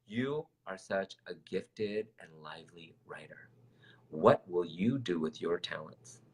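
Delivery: slow, softly imitated British teacher; British accent. Writing teacher tells student she is gifted, British accent. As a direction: deliver slow, hushed praise in a put-on British accent. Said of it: "in her lovely British accent".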